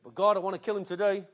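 ordinary delivery

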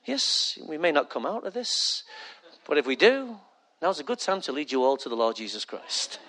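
hushed, low, reassuring announcement; calming captain voice. Acted. Captain preparing passengers for the end, calming hushed voice. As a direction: keep it soft and soothing throughout. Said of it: "kinda calms you down".

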